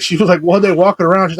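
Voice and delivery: ordinary talk, nothing marked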